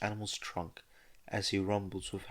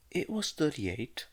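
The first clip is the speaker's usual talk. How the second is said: higher, pouty correction; pouting; 8 semitones higher than usual. A narrator's reading. Student correcting the principal, with a pout. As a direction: sulkily correct her. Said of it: "she argued back in a pout".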